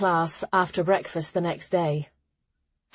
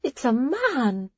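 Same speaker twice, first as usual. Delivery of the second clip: slow, higher shocked exclaim; 6 semitones higher, slower than usual. Acted. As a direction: gasp it out in shock. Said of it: "Jula sounded shocked".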